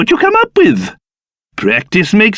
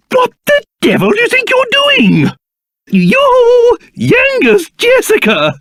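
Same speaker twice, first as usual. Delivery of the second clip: indignant outburst; 13 semitones higher than usual. Acted. King indignant at intruders.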